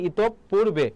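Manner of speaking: ইতপূর্বে split as "itop-purbe", p doubled; pronunciation demo. Shown as a demonstration. Teacher tells students the pronunciation, ইতপূর্বে with doubled p. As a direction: pronounce ইতপূর্বে with a held double p. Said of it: "উচ্চারণটা হবে".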